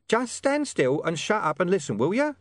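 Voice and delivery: high, impatient interruption; exasperated mystery voice. Acted. Hidden informant telling William to shut up, high and exasperated. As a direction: cut him off, pitched right up.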